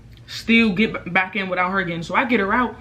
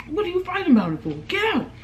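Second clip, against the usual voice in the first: high-pitched, exasperated demand; imitated quarrel, pitched way up; acted indignation; 11 semitones higher, faster than usual. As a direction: argue it again in a high, fed-up voice. Acted quarrel, ordering friend out.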